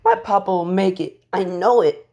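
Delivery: put-on high, loud, distressed child; tearful insistence. Crying girl defends her papa's chances, tearful insistence. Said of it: "Eri starts crying and says".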